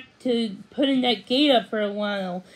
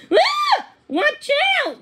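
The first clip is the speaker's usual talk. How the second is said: much higher, louder shouted alarm; yelled warning; 5 dB louder, slower than usual. A narrator's reading. Lizzie yells to her little brother, high alarmed shout. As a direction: scream the warning at him. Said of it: "Lizzie yelled".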